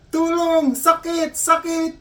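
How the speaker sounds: very high, hammed-up pleading; crying out for help. Injured Pinoy repeating cries to the nurse, mock-desperate wailing. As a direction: wail each word high and separately.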